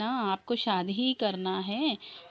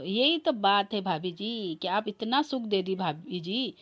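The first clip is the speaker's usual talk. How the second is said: normal talking voice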